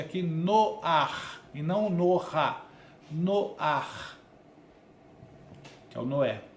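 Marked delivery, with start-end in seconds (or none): none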